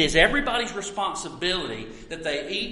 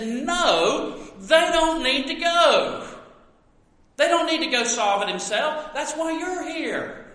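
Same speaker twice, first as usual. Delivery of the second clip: higher, firm and insistent; Jesus voiced firmly; 9 semitones higher, 3 dB louder than usual. Preacher voicing Jesus to disciples, firm and high. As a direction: press the point like a patient teacher.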